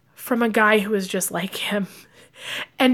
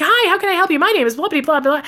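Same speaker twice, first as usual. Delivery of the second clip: much higher, louder eager sales pitch; pushy salesman; 7 semitones higher, 4 dB louder, much faster than usual. Retold or imitated. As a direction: pounce on the customer with a loud greeting. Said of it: "He's like".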